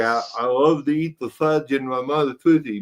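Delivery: words garbled by a held tongue; holding tongue. Retold or imitated. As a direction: say it while pinching your tongue. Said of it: "You'd hold your tongue and say".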